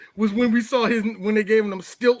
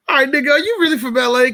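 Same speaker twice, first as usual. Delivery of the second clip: higher, louder and fast, a rush of amused disbelief; 6 semitones higher, 4 dB louder, faster than usual. Retold or imitated. Friend hearing the actor's accent for the first time, higher, louder, fast. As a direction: blurt it out, half laughing at the accent. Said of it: "I'm like".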